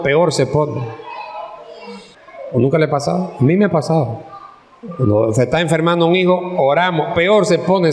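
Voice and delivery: normal talking voice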